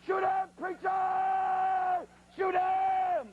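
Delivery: high, long drawn-out shout; distant yelling. Retold or imitated. Fleeing guide to preacher down the road, drawn-out holler. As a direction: holler it out, stretching every word way out.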